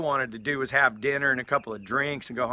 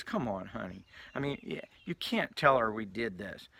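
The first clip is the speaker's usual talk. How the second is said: eye-rolling exasperation; 3 semitones lower, 7 dB quieter than usual. Retold or imitated. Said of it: "starts rolling his eyes".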